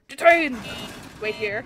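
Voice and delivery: booming playful order; a sharp, gleeful burst of authority; mock official shout. Border guard role, stamping a detention.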